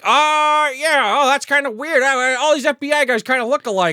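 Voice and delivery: high, loud, hammed-up innocence; feigned ignorance. Acting the cop covering for himself about the lookalike, feigned ignorance.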